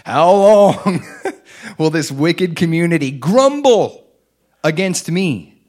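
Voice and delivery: loud, high, slow and booming; God's anger. Acted. Preacher voicing God's anger at grumbling Israel, booming. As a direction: thunder it out as an angry God.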